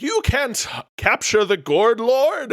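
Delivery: high, loud slow villain boast; villain shout. Acted. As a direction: boom it like a cartoon villain.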